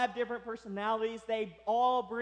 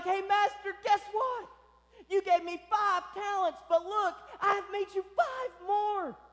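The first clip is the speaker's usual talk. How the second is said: high, loud, eager boasting; excited servant; 10 semitones higher, 4 dB louder than usual. Acted. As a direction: burst out like you can't wait to show the master. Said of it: "He's excited. He can't wait to show his master".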